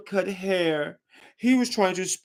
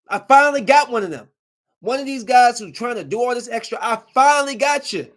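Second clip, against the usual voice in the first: triumphant outburst; 7 semitones higher, 6 dB louder than usual. Real, not acted. Triumphant about catching the driver.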